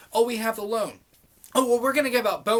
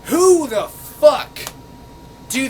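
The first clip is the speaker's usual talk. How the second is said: drawn-out yell, much louder and higher; furious shout; 5 semitones higher, 10 dB louder, slower than usual. Real, not acted. Yelling at the commenter over who makes laws, furious. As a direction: blow up, dragging every word.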